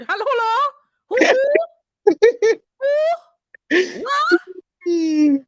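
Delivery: put-on high, loud confused old lady; a grandma who can't hear the caller; granny voice. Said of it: "The granny voice".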